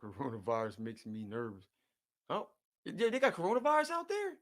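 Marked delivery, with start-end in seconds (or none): 2.3-4.3 jumps way up in pitch, louder, genuinely taken aback; surprised. Real, not acted. Reacting to a friend's Toronto news with a surprised, much higher, louder question. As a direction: caught off guard by the news.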